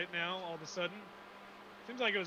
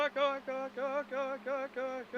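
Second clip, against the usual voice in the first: higher, fading repeated echo; canyon echo; 6 semitones higher than usual. Pretend. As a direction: imitate an echo bouncing back. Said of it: "You guys are gonna have to deal with the echo".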